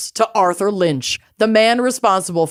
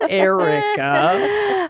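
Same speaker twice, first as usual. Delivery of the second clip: laughing; 9 semitones higher, much slower than usual. Real, not acted. Cracking up over the map.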